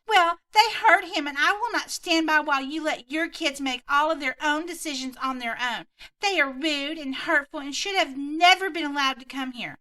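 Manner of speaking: hammed-up furious mom; entitled Karen yelling.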